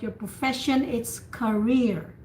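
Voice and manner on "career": slow demo of career; stress on rear. Shown as a demonstration. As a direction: barely voice the first syllable of career, land on rear. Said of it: "the accent is rear".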